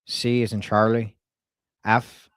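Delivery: spelling out a name in an Irish twang; put-on Irish brogue, letter by letter; Irish accent. Prank caller spelling a surname for a staffer, Irish accent. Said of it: "she really liked my Irish accent".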